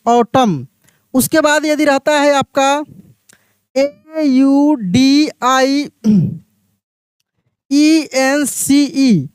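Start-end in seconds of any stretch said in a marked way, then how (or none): 3.8-9.3 letters spelled very slowly one by one; slow spelling out. Shown as a demonstration. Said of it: "इसको हम लोग ऑडियंस पढ़ते हैं".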